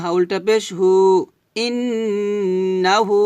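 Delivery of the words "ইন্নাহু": ইন্নাহু with a long humming nasal on ইন্ন and হু held one beat; nasal ghunna. Shown as a demonstration. Teacher showing obligatory ghunna in ইন্নাহু. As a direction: hum through the double n, very slowly. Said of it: "ইন এটা ওয়াজিব গুন্না".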